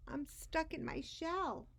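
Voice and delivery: little clam stuck in his shell; frightened whine. Acted. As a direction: whimper it trapped. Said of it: "he told the current".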